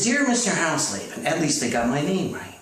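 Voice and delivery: ordinary talk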